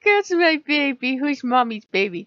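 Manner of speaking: put-on doting baby-talk, high and loud; Mommy Crow. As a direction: gush over your little one. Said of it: "Mommy Crow turns around, she's like".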